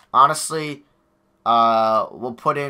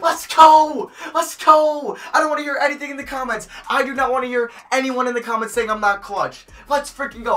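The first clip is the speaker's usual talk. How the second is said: high, loud triumphant yells; victory shout; 11 semitones higher, 3 dB louder than usual. Real, not acted. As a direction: roar after the game-winner. Game-winning shot celebration.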